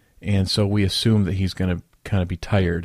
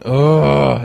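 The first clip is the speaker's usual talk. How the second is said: loud, higher-pitched exasperated "ugh"; exasperated groan; 4 semitones higher, 7 dB louder than usual. Acted. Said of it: "and rolling his eyes".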